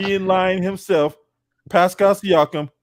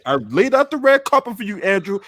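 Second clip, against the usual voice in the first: ordinary talk, nothing marked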